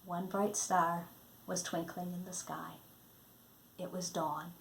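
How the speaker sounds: ordinary delivery, nothing marked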